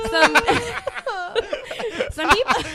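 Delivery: high, loud, tearful laughing; laughing through tears. Real, not acted. Host trying to move on while tearful, laughing. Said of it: "Tears are pouring from her eyes".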